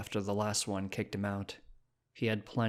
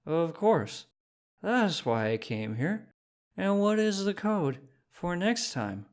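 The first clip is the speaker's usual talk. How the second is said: much higher, smooth and eager; eager drunk; 8 semitones higher than usual. Acted. Phineas playing along with the shopkeeper, eager. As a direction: play it cool but too keen. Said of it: "He just nodded, smoothly".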